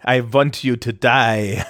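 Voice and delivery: slow, mock German villain accent; hammy Bond villain in a German accent. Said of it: "do a good German accent".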